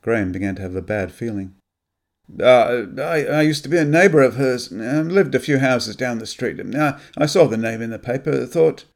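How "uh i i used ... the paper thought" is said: higher and louder, halting with ums; flustered man fumbling for a cover story; nervous stammer. Acted. Graham lies to the suspicious sister, stammering nervously. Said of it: "Graham was struggling".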